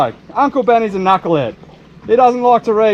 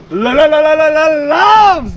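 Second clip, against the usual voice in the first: excited stammer; 5 semitones higher, 4 dB louder than usual. Real, not acted.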